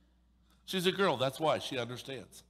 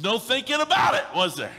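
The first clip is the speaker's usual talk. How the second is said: shout; 7 semitones higher, 7 dB louder than usual. Real, not acted. Preacher exclaims point.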